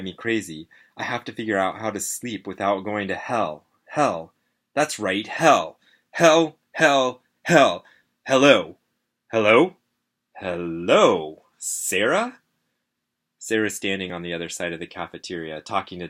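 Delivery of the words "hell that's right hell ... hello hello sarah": loud, punched calls, growing insistent; calling out. A narrator's reading. A dazed kid trying to reach Sarah, calling out. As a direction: call out to get someone's attention. Narrator calls out across cafeteria.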